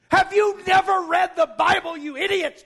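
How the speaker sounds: high-pitched, indignant telling-off; exasperated scolding. Acted. Jesus to those who should know Scripture, exasperated scolding. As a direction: scold them as if they should know better.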